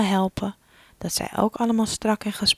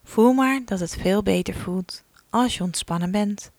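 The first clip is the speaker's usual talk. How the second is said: ordinary talk, nothing marked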